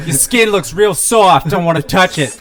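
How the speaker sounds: put-on loud Boston accent. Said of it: "super thick Boston accents".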